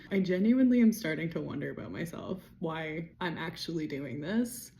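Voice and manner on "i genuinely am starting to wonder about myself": ordinary delivery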